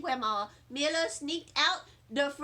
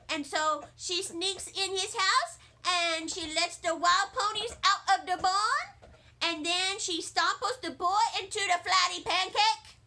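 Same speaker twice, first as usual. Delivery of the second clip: put-on high, eager young girl voice; higher-pitched kid voice telling a revenge story; little girl voice; 4 semitones higher, 3 dB louder than usual. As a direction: play a bouncy kindergartner spinning a tale. Junie tells her revenge story.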